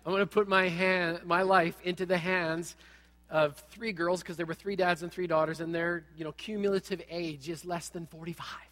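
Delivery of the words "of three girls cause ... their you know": ordinary delivery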